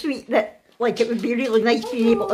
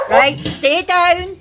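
slow, loud, drawn-out order; raised voice, stretching each word; firm dog command; 5 semitones higher, 4 dB louder, much slower than usual. Real, not acted. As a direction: tell excited dogs firmly to stay down. Commanding dogs as they arrive.

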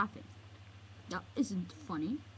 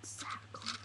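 soft, voiceless whisper; barely-there whispered reply; 11 dB quieter than usual. Acted. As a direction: whisper it very quietly. Repeat of unvoiced reply.

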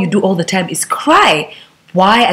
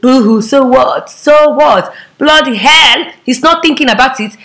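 scornful mocking; 5 semitones higher, 4 dB louder than usual. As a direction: jeer at the sob story, pitched higher. Scornful reply to that lament.

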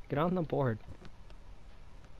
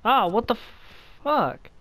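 loud startled curse; startled shout; 10 semitones higher, 7 dB louder than usual. Real, not acted. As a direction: blurt it out in shock. Surprised outburst.

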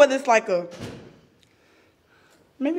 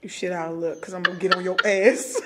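normal talking voice

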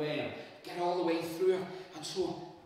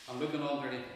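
ordinary delivery, nothing marked

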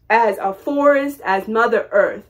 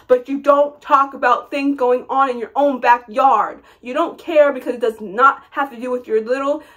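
ordinary talk, nothing marked

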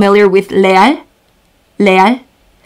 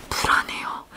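whispered nervously; anxious whisper; 17 dB quieter, much faster than usual. Shown as a demonstration. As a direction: breathe it out in a nervous hush. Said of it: "Before the test".

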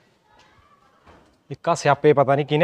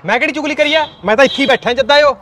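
much higher, louder indignant protest; indignant shout; 10 semitones higher, 7 dB louder than usual. Acted. Husband protests to wife he gossiped with nobody, loud and high. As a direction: shout your innocence. Husband yelling back in quarrel.

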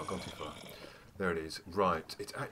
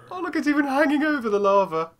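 excited disbelief; 12 dB louder than usual. Real, not acted. Diamond over lava.

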